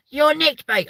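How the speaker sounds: very high squeaky policeman voice; squeaky copper. Retold or imitated. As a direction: squeak out an arrest. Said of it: "really high squeaky voice".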